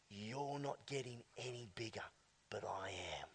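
hushed whispered vow. Acted. Climber whispering to the mountain, hushed. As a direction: breathe it to the mountain.

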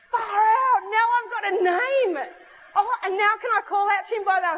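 high, loud burst of amazed joy, acted out; thrilled. Girl discovering she has a name to call, high excited outburst.

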